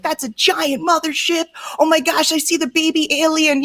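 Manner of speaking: high-pitched mock amazement; excited chat viewer. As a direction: gush like a thrilled UFO fan spotting something. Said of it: "you're in the chat, you're like".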